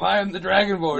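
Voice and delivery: Dragonborn. Acted. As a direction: proclaim it loudly as a game hero. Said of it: "That's like me in Skyrim".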